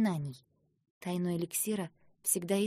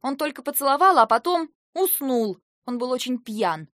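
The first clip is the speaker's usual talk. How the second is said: loud, high defensive outburst; heated full-voiced denial; yelling; 6 semitones higher, 10 dB louder than usual. A narrator's reading. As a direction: shout excuses in protest. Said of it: "заорала я".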